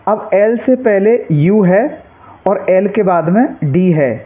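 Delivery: usual speaking voice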